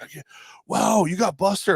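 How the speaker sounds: high, gushing mock amazement. Fans acting starstruck at the host, mock amazement. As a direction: gush like an impressed fan. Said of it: "were just like".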